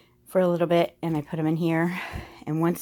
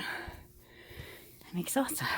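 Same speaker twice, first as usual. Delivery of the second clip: slow, playful whisper of salsa, pitched higher; 8 semitones higher, 12 dB quieter, much slower than usual. Pretend. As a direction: breathe the words like a secret. Repeats salsa, then whispers it.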